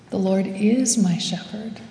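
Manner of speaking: "is" stressed as the key word. Retold or imitated. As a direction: lean on "is", a little subdued. Repeated to self with shifting stress.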